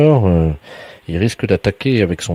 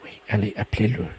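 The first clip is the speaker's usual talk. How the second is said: hushed prompting; speaking softly; 8 semitones higher, 7 dB quieter, faster than usual. Pretend. Researcher urges caller to call the bird, hushed. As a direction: coax the caller under your voice. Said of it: "parler tout doucement".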